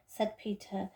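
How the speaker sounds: usual speaking voice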